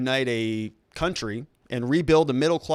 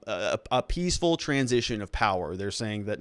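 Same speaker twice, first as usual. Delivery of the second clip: normal talking voice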